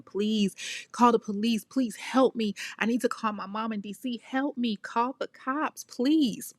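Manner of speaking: high-pitched imitation of desperate begging; retold screaming plea. Runaway wife begging the woman next door, mock-screamed plea. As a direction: plead for help urgently, without a full scream. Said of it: "screaming to the woman that lives next door".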